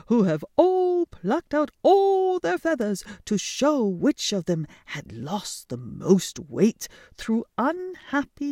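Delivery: high loud scandalised telling; breathless gossip. Acted. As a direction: spread the scandal dramatically. Retold exaggerated tale.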